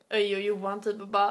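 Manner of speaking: ordinary talk, nothing marked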